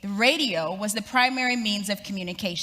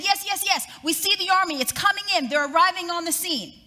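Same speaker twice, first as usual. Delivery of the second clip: much higher, excited eyewitness; excited reporter; 8 semitones higher, 4 dB louder than usual. Retold or imitated. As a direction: cry out as the army arrives. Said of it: "And they said".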